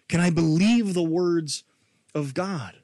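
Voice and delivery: normal talking voice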